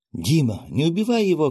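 high, loud pleading cry; urgent shout. Acted. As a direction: cry out to stop him. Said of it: "крикнула Широ". (громкий высокий крик-мольба)

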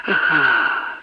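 ordinary delivery, nothing marked

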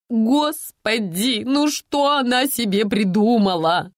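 slow, wailing lament.

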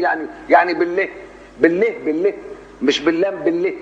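high, repeated "بالله", letter R's name coming out with L; imitated speech impediment. Lisping prophet insists on his letter, R said as L. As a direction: struggle to say the R, getting "بالله". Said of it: "نبي يبقى لدغ".

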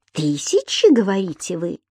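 restrained sarcastic query; scornful echo. A narrator's reading. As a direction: throw the word back as an incredulous question. Landlady scornfully echoes his words.

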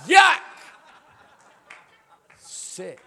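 very loud, high yelp of disgust; mock disgust. Preacher voicing a wife facing her husband's kiss, hammed-up disgust. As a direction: blurt it out as hammed-up revulsion.

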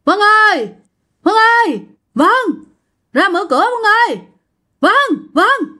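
high, repeated call through a door; urgent calling. Acted. Friend banging on the door to be let in, urgent calling. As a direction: call out insistently, pitched well up. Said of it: "Nó vừa đập cửa mà nó kêu vậy nè".

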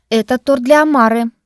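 loud, stern put-down; stern rebuke. A narrator's reading. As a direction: cut him off sternly. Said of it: "сурово осадила его Дарина".

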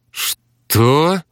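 high, sharp jolt of surprise; startled. Acted. Alina startled by Romeo's warning, surprised. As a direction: jerk up at the news. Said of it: "вскинулась Алина".

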